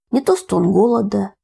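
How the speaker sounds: ordinary delivery, nothing marked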